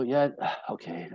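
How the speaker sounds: sighing, grudging okay; a soft resigned okay after a sigh; reluctant sigh. Retold or imitated. Husband grudgingly giving in to his wife, sighing.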